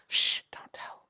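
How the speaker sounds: playful hushed whisper; secretive whisper. Pretend. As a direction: shush them and whisper it. Jokingly asks to keep a secret.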